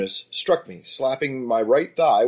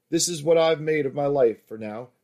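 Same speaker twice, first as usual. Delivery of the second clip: ordinary delivery, nothing marked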